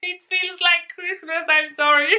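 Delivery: high, giggling words; laughing delight. Real, not acted. Thrilled at the package, high giggling delight. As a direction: laugh through it.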